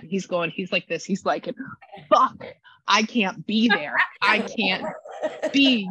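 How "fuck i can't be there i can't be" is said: louder, higher frustrated cry; upset outburst. Retold or imitated. Upset spirit crying out he can't be there, louder. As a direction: blurt it out in frustration. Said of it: "he's mad, he's upset".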